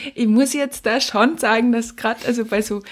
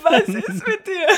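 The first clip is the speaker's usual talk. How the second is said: higher and slow, through laughter; laughing question; 8 semitones higher, slower than usual. Real, not acted. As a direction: laugh the words out in disbelief. Réagit en riant à la critique.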